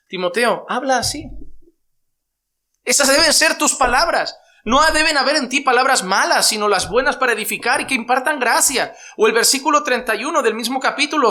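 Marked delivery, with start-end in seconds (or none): 2.8-8.9 loud, high preaching climax; preaching at full force. Acted. Preacher urging Timothy on his words, loud and high. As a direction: drive it home to the congregation, full voice.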